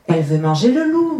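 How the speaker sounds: ordinary delivery, nothing marked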